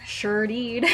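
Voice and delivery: Southern drawl on "Sure did"; Southern accent. Real, not acted. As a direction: let the Southern accent through. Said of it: "the lisp is strengthening your accent".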